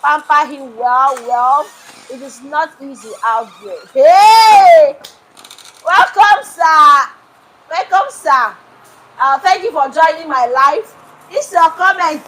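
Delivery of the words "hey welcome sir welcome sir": loud greeting. Real, not acted. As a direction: call out the welcome. Greeting viewer loudly.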